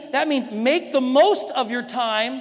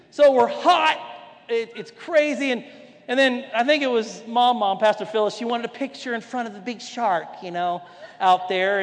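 normal talking voice

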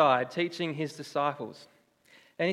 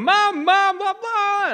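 put-on high, loud childish wail of "Mum, Mum"; kids yelling for mum; 8 dB louder, much slower than usual. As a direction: shout for mum like a whining five-year-old. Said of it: "We're both crying and yelling".

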